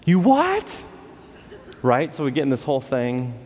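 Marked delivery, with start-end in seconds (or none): 0.1-0.8 high, loud incredulous yelp; friend's stunned high "You what?"; shocked disbelief. Retold or imitated. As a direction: bark it back in disbelief. JD hears about the unleaded.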